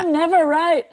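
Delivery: high, loud self-mocking cry; mock lament. Student looked down on for accent, mock lament. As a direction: wail it in comic exasperation. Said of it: "And you're like".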